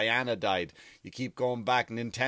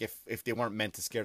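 normal talking voice

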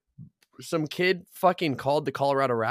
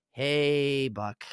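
passive-aggressive greeting. Retold or imitated. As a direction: imitate a boss's passive-aggressive hello. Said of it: "really passive aggressively just going".

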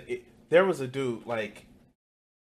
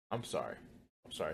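much softer, sheepish sorry; quiet apology; 11 semitones lower, 16 dB quieter, much faster than usual. Real, not acted. Apologising to viewers for the close-up, softly. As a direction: mumble the apology half to yourself. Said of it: "that didn't require a close-up".

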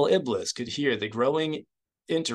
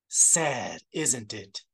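contemptuous; much slower than usual. A narrator's reading. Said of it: "Isard said contemptuously".

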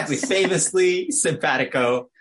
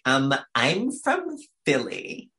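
slow, put-on Philly accent; much slower than usual. Answering people who ask where they're from, mock Philly accent. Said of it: "This is a Philly accent".

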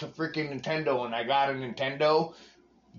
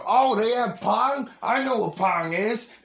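surprised; 7 semitones higher, 4 dB louder than usual. Retold or imitated. Recalling childhood surprise at Atari.

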